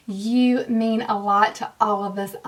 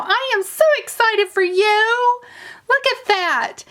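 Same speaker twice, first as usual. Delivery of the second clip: high, loud, gushing over the winner; thrilled squeal; 10 semitones higher, 5 dB louder than usual. Real, not acted. Announcing the subscriber who won, squealing with excitement. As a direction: burst out with real delight.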